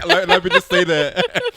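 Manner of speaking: giggling while saying it; words breaking into laughter; laughing. Real, not acted. Laughing aside about Water Country.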